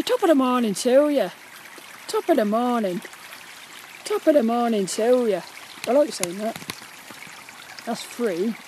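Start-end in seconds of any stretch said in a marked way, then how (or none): 0.0-5.4 stagey Irish greeting; hammed-up Irish accent. Shown as a demonstration. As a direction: put on a stock Irish brogue. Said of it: "with the Irish, you say".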